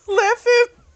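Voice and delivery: words breaking into high laughter; laughing. Real, not acted. Narrator telling how he lost his breath, laughing. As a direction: laugh through the end of the sentence.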